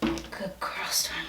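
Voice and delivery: soft whispered praise; whispering. Real, not acted. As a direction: whisper to calm a nervous puppy.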